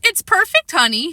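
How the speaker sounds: high childish mock of dad's line; imitating father. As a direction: parrot dad in a little kid's voice. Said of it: "imitating my father".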